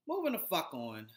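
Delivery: ordinary delivery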